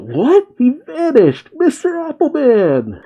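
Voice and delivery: high-pitched cry of shocked disbelief; Luffy. Retold or imitated. Narrator voicing a pirate's startled outcry at something vanishing, high-pitched cry. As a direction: cry it out high, stretching the words. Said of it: "Luffy cries out".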